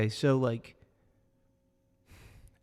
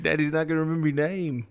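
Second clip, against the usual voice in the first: quick, higher pretend jeer; mock taunting; 3 semitones higher, 4 dB louder, faster than usual. Imagined fighter clowning a rival's kid backstage, mock taunting. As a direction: rub it in, jokingly. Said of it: "come up backstage and be like".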